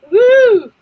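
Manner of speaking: high, loud whoop of joy; excited cheer. Real, not acted. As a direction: let out a happy whoop. Cheering over her shirt.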